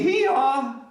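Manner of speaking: high, loud braying hee-haw; donkey bray. Acted. Reading to children, loud high donkey hee-haw.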